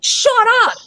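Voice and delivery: high, sharp exasperation; a high fed-up jab; exasperated shut up. Real, not acted. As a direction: flick her off, fed up. Exasperated at sister-in-law.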